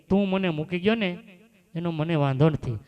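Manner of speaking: a mother's aching, loud rebuke to her son; old mother, fed up with her son, reproaching him loudly. Retold or imitated.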